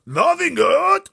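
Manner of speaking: very high, very loud, slow outraged yell. Acted. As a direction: hurl it back in disbelief. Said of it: "yelled the b-, blood bottler".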